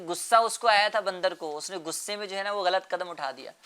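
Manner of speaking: ordinary talk, nothing marked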